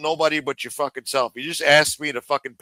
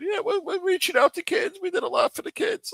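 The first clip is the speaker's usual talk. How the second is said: high, far, squeaky mock voice, much higher; mocking whiny voice. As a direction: parody him in a silly falsetto. Mocking A-Light's kids claim again.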